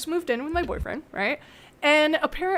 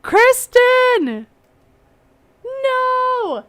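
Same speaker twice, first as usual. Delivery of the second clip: high, loud call for a friend; 11 semitones higher, 6 dB louder, much slower than usual. Real, not acted. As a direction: call her back loudly, then groan no. Calling lost call partner.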